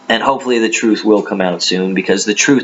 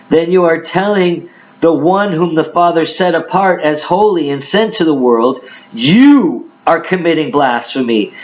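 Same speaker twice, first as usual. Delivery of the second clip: raised, emphatic Bible reading voice; dramatic scripture reading; 5 semitones higher than usual. Acted. Preacher reads Jesus answering the Judeans, dramatic scripture reading. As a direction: declaim the verses at a higher pitch.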